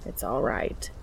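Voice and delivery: low, soft reassurance; reassuring. A narrator's reading. Cass calms Dean after the balloon dips, low and reassuring. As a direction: soothe him, dropping low and quiet. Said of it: "Cass reassures him".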